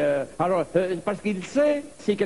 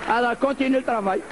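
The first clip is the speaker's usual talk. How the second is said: higher, chuckling voice talking down; a higher, laughing, condescending voice toward the little president; patronizing the president; 6 semitones higher than usual. Acted. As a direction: pat him on the head with your voice.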